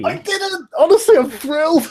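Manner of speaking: very high, loud, gleeful squeal; thrilled. Real, not acted. Game master whose map gets used at last, thrilled. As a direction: burst out delighted, voice shooting way up. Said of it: "can't tell you how excited I am".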